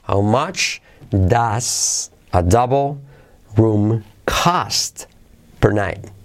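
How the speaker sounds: slow, separated chunks with long pauses; word by word. Shown as a demonstration. Teacher modelling a price question for learners, word by word. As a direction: spell the question out slowly, piece by piece. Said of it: "Vamos con esto".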